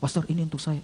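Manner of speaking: very soft, understated question; moved recipient. Retold or imitated. Young man receiving the envelope from pastor, soft and moved. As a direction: ask it quietly, lightly imitating him. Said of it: "Dia menangis".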